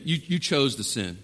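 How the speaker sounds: ordinary talk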